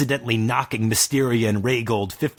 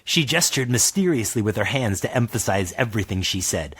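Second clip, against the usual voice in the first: ordinary delivery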